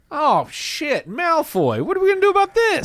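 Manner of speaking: very high, squeaky author in a fluster; hammed-up high-pitched dismay; mock panic. Author suddenly remembering a neglected character, in high mock panic.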